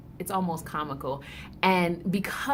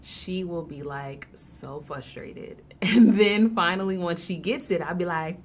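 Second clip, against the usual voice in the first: ordinary talk, nothing marked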